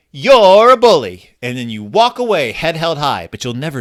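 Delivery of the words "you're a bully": loud, slow, firm declaration; mock brave shout at the bully; declared loudly. Shown as a demonstration. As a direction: stand tall and call them out slowly. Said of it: "you can say".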